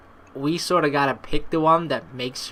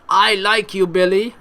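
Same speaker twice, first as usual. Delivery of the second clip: loud, higher mock confession; blatant declaration; 5 semitones higher, 7 dB louder than usual. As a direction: announce it like a huge, obvious sign. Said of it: "hold up a sign that says".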